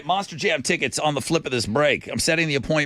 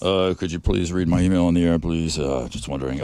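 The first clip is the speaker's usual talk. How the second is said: much lower, droning put-on voice; deep, level, pleading email voice; Radio Wizard; 10 semitones lower than usual. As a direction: read it low and monotone, as a character. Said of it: "do it in the Radio Wizard voice".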